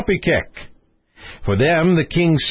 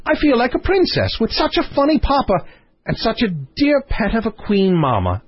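high, gushing princess voice; playful princess; 9 semitones higher than usual. Acted. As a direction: play a fond, giddy princess, pitched high. Princess character in story.

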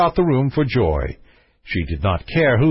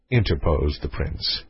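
ordinary delivery

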